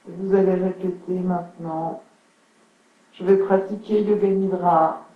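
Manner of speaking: unhurried yoga nidra guidance with long pauses; guided relaxation. Acted. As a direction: settle listeners into stillness, phrase by phrase. (voix posée de relaxation guidée)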